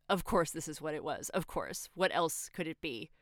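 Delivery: ordinary delivery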